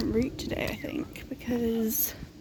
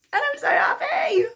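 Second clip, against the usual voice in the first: excited; 5 dB louder than usual. Real, not acted. Thrilled after finishing her run.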